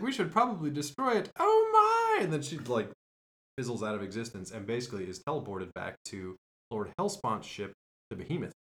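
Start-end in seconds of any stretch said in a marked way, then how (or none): 0.0-2.2 high, loud alarmed cry of oh my; high startled gasp. Retold or imitated. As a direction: shriek the oh my in alarm. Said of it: "She's like".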